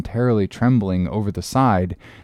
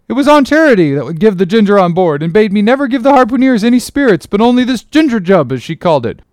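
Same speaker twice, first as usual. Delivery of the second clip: panicked steward; 10 semitones higher, 6 dB louder, faster than usual. Acted. Doughboy pleads in panic.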